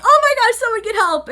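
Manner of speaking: high, loud panicked shriek; panicked servant. Retold or imitated. Servant panicking as Kurono bleeds, shrieking high. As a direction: squeal for help in a panic.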